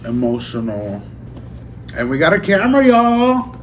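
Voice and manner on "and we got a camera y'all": high, loud squeal of delight; excited. Real, not acted. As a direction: shout the big news, thrilled. Excited reveal of new camera.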